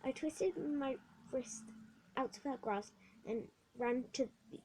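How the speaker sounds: ordinary delivery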